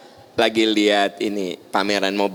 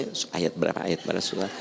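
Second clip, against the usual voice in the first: mumbled to self; 6 semitones lower, 11 dB quieter, much faster than usual. Real, not acted. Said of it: "saya mah orangnya sok rudetannya".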